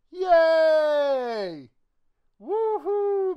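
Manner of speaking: loud, high cheering; excited cheer. Real, not acted. Celebrating the card he pulled, loud cheering. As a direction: whoop it out in triumph.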